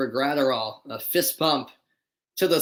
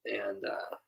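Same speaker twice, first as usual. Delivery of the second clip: whisper; 5 semitones lower, 13 dB quieter than usual. Real, not acted. Aside while explaining bad lighting.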